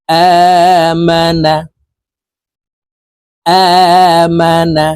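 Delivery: আমানা chanted slowly, first আ held long; drawn-out vowel. Shown as a demonstration. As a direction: model আমানা with a stretched opening vowel. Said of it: "এক আলিফ টেনে পড়ব". (আমানা-র আ টেনে ধীরে পড়া)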